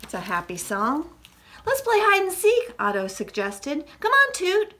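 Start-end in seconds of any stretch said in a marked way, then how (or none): 1.6-2.7 put-on high, excited child-story voice; eager suggestion. A narrator's reading. Character eagerly suggests a game. 4.0-4.7 high, lively call to a friend. A narrator's reading. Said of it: "Puddle called".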